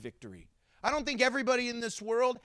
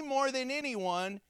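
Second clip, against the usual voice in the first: ordinary talk, nothing marked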